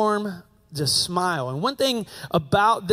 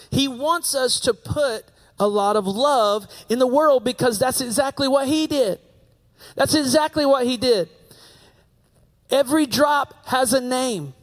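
higher, fervent sermon build; preaching; 6 semitones higher than usual. Acted. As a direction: preach it out with rising conviction.

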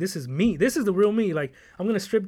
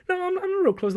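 high imitating voice; 14 semitones higher than usual. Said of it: "My dad's like".

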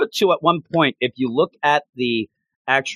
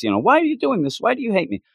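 higher, hurt and baffled protest; exasperated Lando; 10 semitones higher, faster than usual. Retold or imitated. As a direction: plead "why" in disbelief. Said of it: "Lando says".

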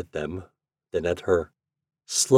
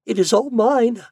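much higher, louder insistent offer; pleading Yuli; 6 dB louder than usual. Acted. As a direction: press the money on him, begging.

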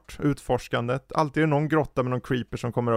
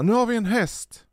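put-on higher child voice, proudly announcing; imitating proud kids; 9 semitones higher than usual. Kids coming back to dad with a horse, higher proud voice. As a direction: mimic the kids showing off their find. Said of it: "och de kom med en häst och sa".

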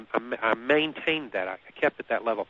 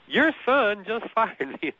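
higher, slower mock announcement; 10 semitones higher, slower than usual. Retold call to dad, comic peak.

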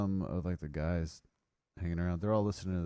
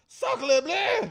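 high, loud cartoon French cry; French accent; 7 dB louder than usual. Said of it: "It's like".

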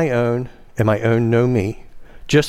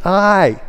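"I" declared high and drawn out; stress on I; 6 semitones higher, 3 dB louder than usual. Shown as a demonstration. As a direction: land hard on "I" as a declaration. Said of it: "It's to place emphasis".

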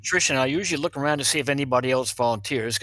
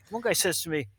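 normal talking voice

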